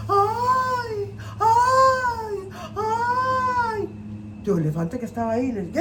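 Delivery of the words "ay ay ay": high hammed-up wails of distress; mock wailing cries. Tío Conejo faking distress to lure the elephant, high wails. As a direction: wail like a telenovela heroine. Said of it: "empezó a pegar unos alaridos".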